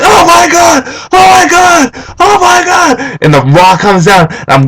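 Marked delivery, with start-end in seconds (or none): 0.0-3.0 reenacted high-pitched fan scream. Fan answering his girlfriend as The Rock returns, high screaming. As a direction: repeat it shrieking, beside yourself. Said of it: "I'm screaming".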